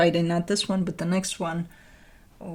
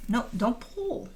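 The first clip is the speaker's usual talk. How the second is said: ordinary delivery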